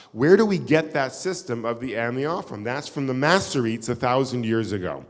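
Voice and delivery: ordinary delivery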